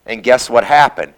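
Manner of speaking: ordinary delivery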